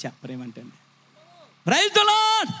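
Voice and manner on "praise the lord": high-pitched praise call to the congregation; rousing call. Real, not acted. (ఉత్సాహంగా పైస్థాయిలో పిలుపు)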